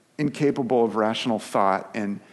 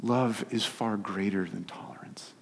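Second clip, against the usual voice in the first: hushed, breathy emphasis; whispered; 7 dB quieter than usual. Acted. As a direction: drop to a whisper to make it land. Preacher drops to whisper for emphasis.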